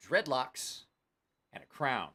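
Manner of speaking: ordinary talk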